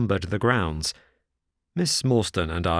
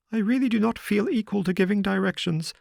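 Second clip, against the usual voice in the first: higher, lightly voiced nervous little man; Sholto's voice; 12 semitones higher than usual. A narrator's reading. Sholto's voice in the story.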